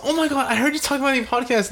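high, fast imitation of her; hurried, high-pitched mock fan; mock apologetic woman. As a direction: gush it in a put-on high voice. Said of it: "She fucking, like, hears the episode".

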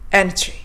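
エナジー with teeth out, sharp sound; pointed English energy; 唇むきっとしたままエナジー; duck-lip pronunciation. Shown as a demonstration. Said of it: "唇むきっとしたまま発音して".

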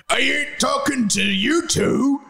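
high, slow, slurred character voice; Hagrid. Acted. Hagrid tells off the two students, in character. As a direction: drawl "talking to you two" in character. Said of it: "said Hagrid".

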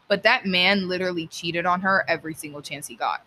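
ordinary delivery

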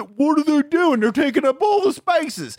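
high-pitched indignant griping; mock outrage. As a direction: whine like an outraged hypocrite driver. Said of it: "and then gets mad".

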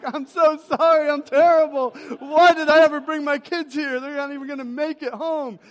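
loud, high mock meltdown; hammed-up despair. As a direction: wail your apology like a comically failed dad. Dad's hammed-up meltdown after second fall.

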